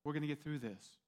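soft, gentle comfort; hushed, tender promise to a friend; imagined reassuring Jesus. Pretend. Jesus comforts the one who denied him, soft and tender. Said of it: "He's saying".

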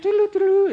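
high, loud sung toot of a marching band; imitated band fanfare. Preacher mimics the marching band for the congregation, high tooting. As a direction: toot it out like a parade horn.

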